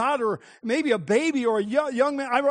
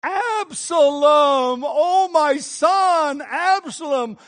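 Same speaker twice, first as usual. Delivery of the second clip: very slow, loud, higher wail over Absalom; grieving lament; 4 semitones higher, 6 dB louder, much slower than usual. Acted. King David mourning his rebel son, slow wailing lament. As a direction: mourn a lost son, drawing out each word. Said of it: "And David says".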